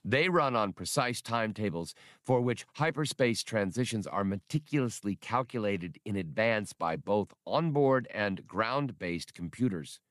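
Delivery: plain reading voice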